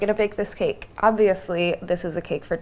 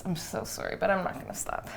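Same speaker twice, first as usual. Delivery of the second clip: quiet aside; 8 dB quieter than usual. Real, not acted. Apologetic aside.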